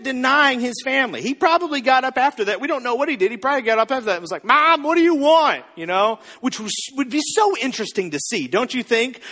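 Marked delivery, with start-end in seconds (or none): 4.4-5.6 loud, high, put-upon son; mock yelling. Jesus calling out to his mom, mock exasperated yell. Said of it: "Thirty-year-old Jesus yelling at his mom".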